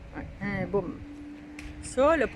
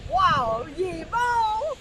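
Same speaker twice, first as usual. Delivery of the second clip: mock-marvel at the partner, pitched up; retold admiration; 7 semitones higher, much slower than usual. Recalling watching the partner at work, high-voiced admiration. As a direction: voice rising high in an admiring wow. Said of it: "Je te regardais puis je disais".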